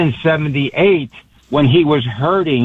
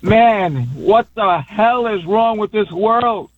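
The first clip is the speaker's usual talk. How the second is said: pleading, upset, pitched up; emotional outburst; 6 semitones higher than usual. Real, not acted. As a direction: voice rising high, choked with real grief. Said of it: "it's an emotional day for me".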